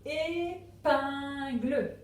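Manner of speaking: ÉPINGLE stretched into é... pin... gle; slow, separate beats on each part of ÉPINGLE; syllable by syllable. Shown as a demonstration. Teacher giving the third word, ÉPINGLE in drawn-out syllables. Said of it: "autant de cases qu'il y a de syllabes".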